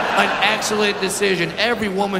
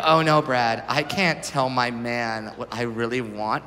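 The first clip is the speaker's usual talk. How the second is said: lower, softer, slow whiny protest; a touch of shy pleading; mock coy woman; 9 semitones lower, 6 dB quieter than usual. Women telling Brad they cannot speak up, mock coy and soft. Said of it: "women pull this line and they go".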